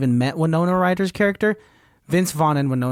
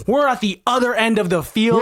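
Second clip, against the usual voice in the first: higher, rising panic about the field; stressed out; 6 semitones higher than usual. Retold or imitated. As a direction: act the panicking partner. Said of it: "gets more and more stressed out".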